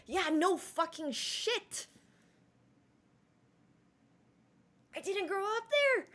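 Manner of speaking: high, loud, slow and fed up; sarcastic high-pitched comeback; exasperated retort. Retold or imitated. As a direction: snap back with mock outrage, dragging it out. Exasperated retort to accent remarks.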